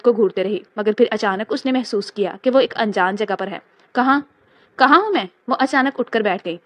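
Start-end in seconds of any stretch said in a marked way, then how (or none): 3.9-5.2 rising, alarmed where am I; startled awake and scared, pitch jumping up on کہاں; frightened confusion. A narrator's reading. Waking in a strange place. (گھبرائی ہوئی آواز میں سوال)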